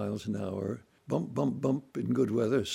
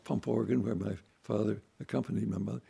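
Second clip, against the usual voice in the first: ordinary delivery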